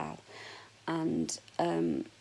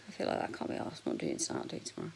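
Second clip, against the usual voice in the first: quiet aside; 6 dB quieter, much faster than usual. Real, not acted. Lazy aside about hair.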